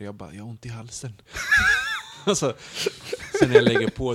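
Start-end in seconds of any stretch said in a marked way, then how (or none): 0.3-1.1 softer, fake sore-throat voice; mock hoarse whisper. Telling mom on the phone, a soft faked sore throat. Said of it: "jag börjar viska". 1.2-2.2 loud high laugh bursting out; burst of laughter. Real, not acted. Laughing at the punchline of the train story. 2.2-4.1 talking loud through laughter; laughing. Real, not acted. Laughing while telling a friend about the train.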